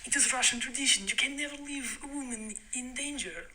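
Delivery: Russian guys. Retold or imitated. Storyteller quoting Russian guys on their tradition, mock Russian accent. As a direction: put on an insistent Russian accent. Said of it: "And the guys are like".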